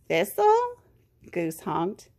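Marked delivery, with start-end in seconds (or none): 0.1-0.8 high, rising surprise; astonished question. A narrator's reading. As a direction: ask it in amazed recognition. Goose astonished to recognise Thistle.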